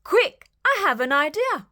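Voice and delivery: ordinary delivery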